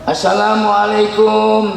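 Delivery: slow drawn-out salam at the door; slow beggar's greeting. Acted. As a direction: call out slowly to the house. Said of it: "Datang pengemis".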